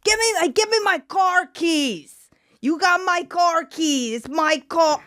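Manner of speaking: mock drunk yelling over car keys; hammed-up shout for the keys. Guests yelling again at each other.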